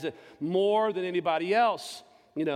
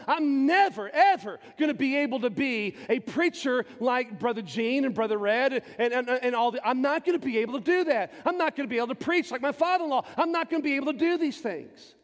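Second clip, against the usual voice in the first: higher and louder, pleading self-doubt; mounting despair; 7 semitones higher, 4 dB louder than usual. Retold or imitated. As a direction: pile up the fears, each line more desperate. Preacher relives his despair.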